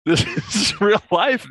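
laughing through the words, pitched high; laughing insistence. Real, not acted. Assuring a stunned cohost it's real, laughing. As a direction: insist it's true while cracking up.

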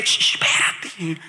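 slow, drawn-out whispered shushing; whispered hush. Retold or imitated. Husband shushing his crying wife in a retold scene, whispered hush. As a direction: hush her slowly under your breath.

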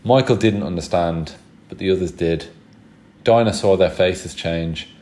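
ordinary delivery

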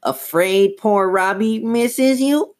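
slow, high puppet voice; drawn-out squeaky dummy question; Slappy. A narrator's reading. Dummy asks Chris, high drawn-out puppet voice. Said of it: "Lindsay made Slappy ask".

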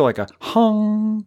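high, sustained hum on "hum"; attempted glowing-nose sound, "hum" drawn out high; imitated nose noise. Shown as a demonstration. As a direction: hold "hum" out long on one high note. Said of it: "making this noise of Rudolph's nose".

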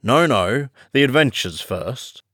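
impatient Griffin, brushing it aside. Acted. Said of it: "in an impatient tone".

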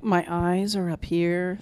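slow, put-on creepy voice; friend's bit as a serial killer. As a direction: let it creep out slowly. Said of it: "That's [name]'s, [name]'s impression of, uh, Ted Bundy".

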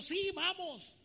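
high, loud eager yes; eager agreement. Retold or imitated. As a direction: jump at the offer. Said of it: "le dijo la vecina". (la vecina acepta con entusiasmo, aguda y fuerte)

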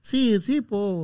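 high, thin mock Chilean voice; Chilean accent. Host mocking how people imitate Chileans, Chilean accent. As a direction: raise the voice into a soft Chilean caricature. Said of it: "no ponga la voz así".

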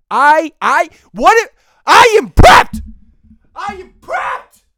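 high, loud hammed-up battle cry; fired up. Hyping himself up for punishment, high fired-up yell. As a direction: scream that you're ready, pumped up. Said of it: "I'm getting fired up".

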